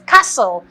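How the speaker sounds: CASTLE with the T left silent. Shown as a demonstration. Spelling teacher introducing a word, CASTLE with silent T. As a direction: say CASTLE as cassel, no T. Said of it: "The T is silent".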